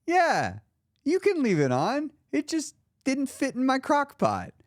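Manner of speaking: lightly voiced grandma, amused by her grandchild's question. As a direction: let a grandmother gently explain the obvious. Grandma answers again.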